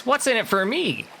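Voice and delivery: high-pitched small bird voice; little blue bird. Acted. Blue bird asked to help cross river, high bird voice. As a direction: pipe it up high like a tiny bird. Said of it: "This little blue bird says".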